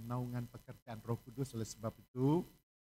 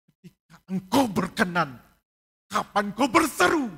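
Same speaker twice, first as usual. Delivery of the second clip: loud, ringing quotation of scripture; proclaimed scripture; 7 semitones higher, 15 dB louder than usual. Acted. Pastor quoting Corinthians to the church, loud proclamation. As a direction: declare the verse to the church. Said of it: "Korintus menuliskan".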